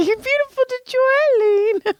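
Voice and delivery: hammed-up cooing compliment, voice way up; mock gushing. Joy reassures co-host who looks trashy, mock gushing.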